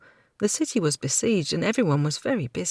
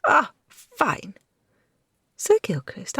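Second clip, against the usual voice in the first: higher, mocking grudging apology; a lightly voiced cheeky girl sneering through it; sarcastic young woman; 8 semitones higher than usual. Kirsten voiced high by narrator.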